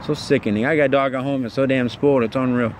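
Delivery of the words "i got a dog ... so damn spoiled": ordinary delivery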